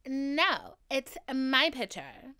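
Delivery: loud, drawn-out mock drunk protest; drunk refusal. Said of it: "'cause I was drunk".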